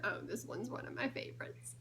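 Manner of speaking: usual speaking voice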